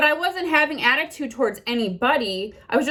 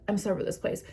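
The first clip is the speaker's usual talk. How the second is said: quiet aside; 8 dB quieter, much faster than usual. Shown as a demonstration. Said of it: "I'm just like".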